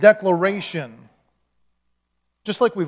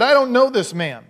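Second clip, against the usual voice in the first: raised, louder denial; emphatic denial; 6 semitones higher, 6 dB louder than usual. Acted. Peter denying Jesus to bystanders, emphatic denial. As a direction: deny it forcefully, pitch jumping up. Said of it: "he denied it with an oath".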